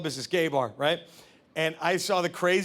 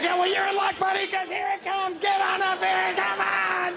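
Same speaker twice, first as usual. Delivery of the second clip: mock eager offer; 12 semitones higher than usual. Eager guy calls his buddy up, hammed-up high voice. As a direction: ham up a huge eager welcome.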